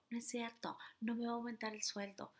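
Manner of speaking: hushed sceptical inner voice; quiet inner voice. Shown as a demonstration. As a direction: say it under your breath like a hidden thought. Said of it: "Y aquí abajo estás".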